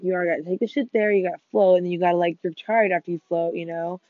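usual speaking voice

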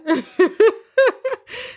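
high-pitched giggling fit; laughing. Real, not acted. Doubting she will ever be bilingual, laughing. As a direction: giggle at your own overconfidence.

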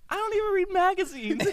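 shrieked comic panic, very high; mock freak-out. Friend admitting the story is false, mock freak-out. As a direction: blurt it at the top of a squeaky voice. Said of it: "So they're, like, freaking out".

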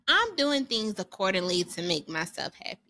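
ordinary delivery

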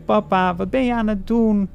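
put-on high-pitched child calling to Dad; child's voice. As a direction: call out like a puzzled child. Child questions father from upstairs.

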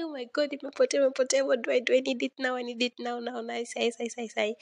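mock frantic whine that fades as it repeats; acted panic. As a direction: play a tearful panic, words tumbling out. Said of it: "you just panic. You just cry".

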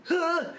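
sharp high gasp; mock startled gasp. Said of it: "I'm always like".

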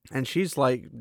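ordinary delivery

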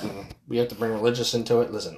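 usual speaking voice

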